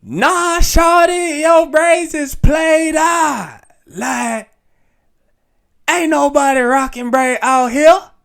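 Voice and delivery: high, loud, slow southern drawl mock; southern accent, sassy and high. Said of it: "my southern accent".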